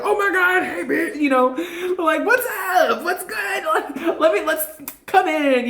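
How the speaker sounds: high, giggly hey-bestie greeting; excited spirit. Retold or imitated. Spirits greeting the reader like a best friend, high laughing. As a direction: squeal a hello to your best friend. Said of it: "They're like".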